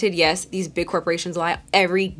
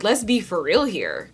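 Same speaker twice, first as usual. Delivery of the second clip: ordinary talk, nothing marked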